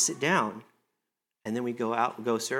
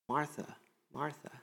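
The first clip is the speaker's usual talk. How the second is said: "Martha, Martha" said much softer, almost hushed, rising; soft repeat; 11 dB quieter than usual. Shown as a demonstration. Preacher repeating Jesus's call to Martha, soft and inviting. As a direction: murmur "Martha, Martha" as a tender invitation.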